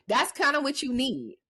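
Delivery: ordinary talk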